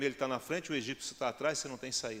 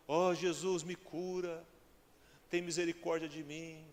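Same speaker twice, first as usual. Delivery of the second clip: restrained, drawn-back little plea for healing; meek plea; 4 semitones higher, slower than usual. Acted. Preacher acting the blind man asking wrongly, meek plea. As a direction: plead timidly, without crying out. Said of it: "Foi assim, não? Ou ele falou assim".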